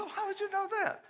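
high, fast, suspicious query; spring it high and quick; mock suspicious. Dad questioning the young man, high suspicious voice. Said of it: "I said".

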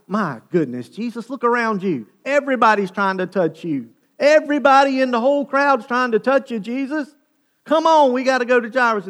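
loud, high exasperated protest. Acted. Peter urging Jesus past the pressing crowd, exasperated. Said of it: "probably rolling his eyes, going".

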